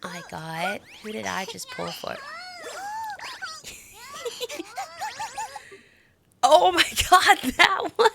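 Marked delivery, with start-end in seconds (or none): 2.2-5.8 long, very high giggly squeal; drawn-out high-pitched laugh. Real, not acted. Reacting to forgotten character pull. 6.4-8.1 loud, high, laughing exclamation; high squealed excitement; excited outburst. Real, not acted. Excited over the new character.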